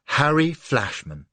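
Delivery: slow, warm recognition; slow smiling greeting. A narrator's reading. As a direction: savour the name with a smile. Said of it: "slowly she smiled".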